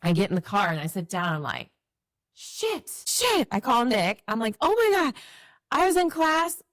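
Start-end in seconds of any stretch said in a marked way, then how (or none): 2.3-3.5 high-pitched dismayed swearing, retold; dismayed cursing. Sinking realisation alone in car. 4.6-6.6 retold panic. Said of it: "like, panicking, called Nick".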